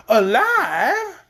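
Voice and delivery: high, loud startled question; amazed gasp. A narrator's reading. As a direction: gasp it out in wonder. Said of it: "gasped Aunt Em in amazement".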